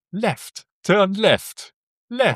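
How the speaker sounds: high tinny robotic turn signal voice; tinny speaker voice. Retold or imitated. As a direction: imitate a cheap navigation speaker. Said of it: "mit so einer richtig blechernen, billigen Lautsprecher".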